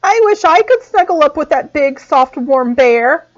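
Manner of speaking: high tiny mouse voice; sniffly mouse voice. A narrator's reading. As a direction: plead like a cold little mouse. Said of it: "sniffed the mouse".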